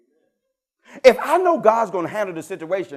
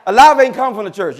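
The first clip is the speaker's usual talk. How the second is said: 1 loud preaching call; 3 semitones higher, 7 dB louder than usual. Real, not acted. Preacher calls out congregation.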